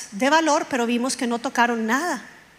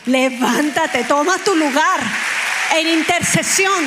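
louder, rising call to stand up; fervent exhortation; 3 semitones higher, 4 dB louder than usual. Real, not acted. Preacher urging the congregation to intercede, loud rallying call. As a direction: rouse the church to pray.

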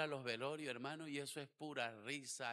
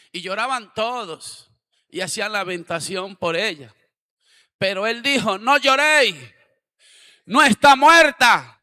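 loud, high pulpit shout building; fiery preaching; 7 semitones higher, 26 dB louder than usual. Acted. Pastor reads Jairo's story to the congregation, preaching loudly. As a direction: proclaim it to the whole church.

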